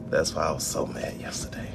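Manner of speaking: a whispered aside of frustration. Real, not acted. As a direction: breathe it out under your breath. Repeating his complaint under breath.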